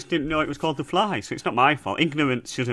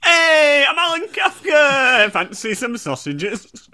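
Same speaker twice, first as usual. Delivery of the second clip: high, slow, shouted upbeat chip shop owner; loud and cheery, dragging the words out; Alan Kafka impression; 13 semitones higher, 3 dB louder, much slower than usual. Doing Alan Kafka for his co-host, high and hammy. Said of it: "You do an impression of Alan Kafka".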